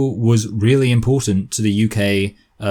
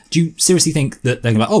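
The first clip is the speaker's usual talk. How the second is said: ordinary delivery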